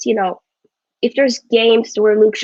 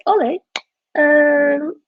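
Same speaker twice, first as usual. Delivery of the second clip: put-on half-hearted British accent, a little higher; a clumsy try at British English; bad British accent; 6 semitones higher, much slower than usual. Vlogger voicing Ed Woodward to Ole, bad British accent. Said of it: "That was a horrible accent".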